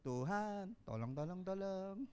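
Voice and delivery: half-hearted mumbling please-help; mock meek request nobody would make; timid plea. Shown as a demonstration. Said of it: "tidak katik dia ngomong".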